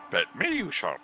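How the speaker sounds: a failed cat impression that drifts into Yoda; a bit Yoda. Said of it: "yeah, a bit Yoda".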